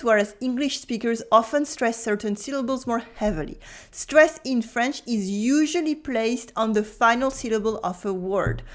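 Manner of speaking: ordinary talk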